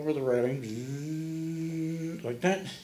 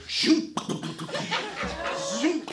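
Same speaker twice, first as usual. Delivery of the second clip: whoosh sound effect; 11 semitones higher, 4 dB louder than usual. Retold or imitated. Zodiac dropping down.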